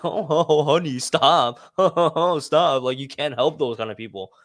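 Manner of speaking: mock amused laugh between the words; laughing wife. Wife to her enraged husband on the side, mock laughing. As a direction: imitate a wife laughing along as she scolds. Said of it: "his wife was just laughing in the side".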